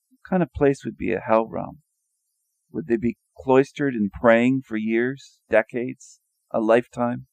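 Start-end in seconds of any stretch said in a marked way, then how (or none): none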